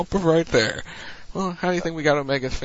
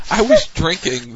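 sputtering laugh mid-drink; spray out the drink, laughing; spit take; 7 dB louder than usual. Real, not acted. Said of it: "Did I get the spit take?".

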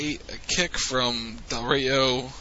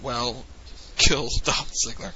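ordinary talk, nothing marked

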